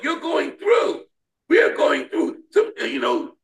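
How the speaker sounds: ordinary delivery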